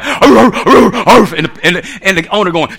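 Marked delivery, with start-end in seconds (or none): 0.0-1.3 imitated dog barks; dog barking. Preacher imitating a dog out in the yard, barking. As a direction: bark gruffly like a yard dog. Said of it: "You get a dog out there".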